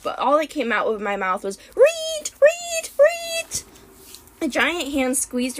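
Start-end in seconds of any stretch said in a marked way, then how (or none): 1.7-3.6 very high, loud squealing reet; shrill rodent cries for help; guinea pig squeak. A narrator's reading. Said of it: "tried to scream for help".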